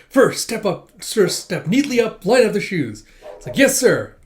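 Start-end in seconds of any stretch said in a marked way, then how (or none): 0.1-3.0 loud, high rapid-fire orders; all energetic. Retold or imitated. Senior drills junior backstage, energetic loud commands. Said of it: "just all energetic being like". 3.6-4.1 loud, high acknowledgement; eager obedience. Retold or imitated. Junior answers senior's drill, eager loud reply.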